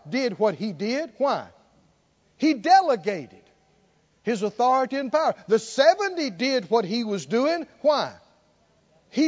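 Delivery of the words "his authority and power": ordinary delivery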